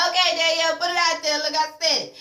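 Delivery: egging her on, voice up; excited mock cheer. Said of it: "I was like".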